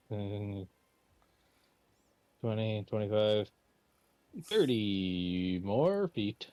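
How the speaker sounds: ordinary talk, nothing marked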